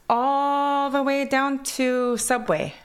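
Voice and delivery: put-on "All the way down to Subway" in Kwakwala-accented English, high and slow; slow, lilting Kwakwala accent in English. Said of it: "my accent from Kwakwala goes into English".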